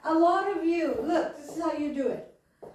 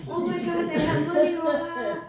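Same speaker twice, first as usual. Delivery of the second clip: louder burst of mock amazement; excited retelling; 3 semitones higher, 7 dB louder than usual. Preacher recalling the thrill in the car, acted excitement. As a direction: act out an excited reaction, loudly. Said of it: "they were excited, I was excited".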